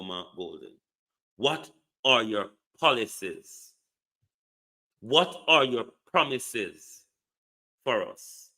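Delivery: normal talking voice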